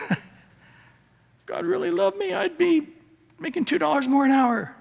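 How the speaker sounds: high, laughing mock grumble; hammed-up high whine about God and money; mock whiny complaint. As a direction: lampoon a greedy believer's whining. Preacher mocks a grumbling believer.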